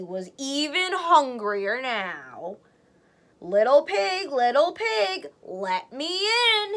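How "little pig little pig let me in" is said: slower, louder hammed-up wolf growl. Wolf at the stick house, slow louder growl. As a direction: drag each word like a greedy wolf. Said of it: "he growled".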